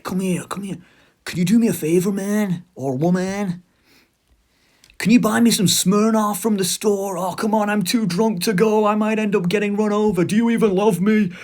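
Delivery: put-on high-pitched, wheedling drunk voice; slurring drunk brother. Alcoholic little brother begs for Smirnoff, drunken pleading. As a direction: plead and whine like a hammed-up drunk. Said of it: "he slurs".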